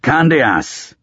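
high sharp shout. Acted. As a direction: call it out loud. One Eye calls out in cold.

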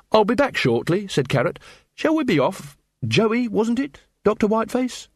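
lightly voiced earnest Carrot. A narrator's reading. Carrot cheerfully insisting on the museum visit, lightly voiced.

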